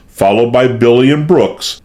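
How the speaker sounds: ordinary delivery, nothing marked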